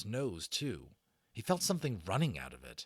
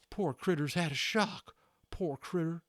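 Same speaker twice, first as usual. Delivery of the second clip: lightly voiced cowboy, higher; Boone's phrase repeated; 9 semitones higher than usual. A narrator's reading. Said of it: "kept repeating to himself".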